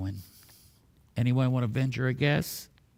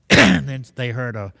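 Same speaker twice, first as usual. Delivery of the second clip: usual speaking voice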